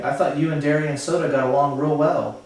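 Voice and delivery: plain reading voice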